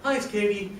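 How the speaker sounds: a producer's upbeat greeting, pitched high; bright phone greeting. Retold or imitated. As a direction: pitch the hello up like a cheerful caller. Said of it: "Katie Fox, and she said".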